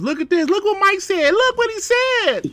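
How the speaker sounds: high-pitched excited fanboy squeal; mocking fanboy voice. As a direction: gush in a very high, put-on voice. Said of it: "gotta do a voice like that".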